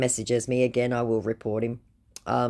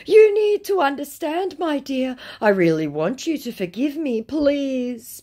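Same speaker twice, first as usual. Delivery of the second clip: high, over-emotional imitation of a scammer; mock pleading; 11 semitones higher, 5 dB louder than usual. Scammer asking his dear to forgive him, mock pleading. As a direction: implore loudly at first, hammed up.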